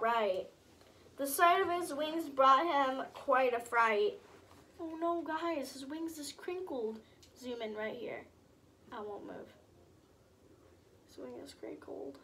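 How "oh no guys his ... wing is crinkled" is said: much softer, confiding to the viewers; soft side comment; hushed aside. Real, not acted. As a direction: lean in and point quietly. Hushed aside pointing at picture.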